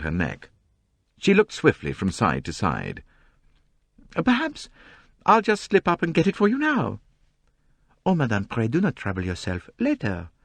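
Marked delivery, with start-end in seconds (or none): 4.1-7.0 high, complaining society novelist. A narrator's reading. Mrs Otterbourne fidgety. 8.1-10.3 put-on polite Belgian-French detective accent; courteous French-accented reply; gentle French accent, very polite. A narrator's reading. Poirot declines politely.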